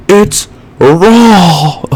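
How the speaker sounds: high, loud mock chef outburst; Gordon Ramsay impression. Vlogger imitating a TV chef scolding a cook, mock outraged yell. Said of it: "Gordon Ramsay's like".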